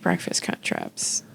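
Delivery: unvoiced, almost silent; whispered. Real, not acted. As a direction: breathe the words out. Murmured aside.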